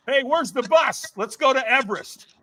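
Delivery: high-pitched hyped-up eagerness; acted euphoria. Firewalker ready for Everest, acted overexcited bravado. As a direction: play someone on an unstoppable high.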